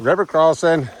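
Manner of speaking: a loose, admittedly off take on a robot guide announcing a river; German GPS voice. Retold or imitated. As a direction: hiker mocking his app's German voice calling out a crossing. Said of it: "with that German voice".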